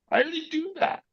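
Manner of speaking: tiny, very high, soft squeak; mock little kid. Son claiming he already knows the technique, childlike squeak.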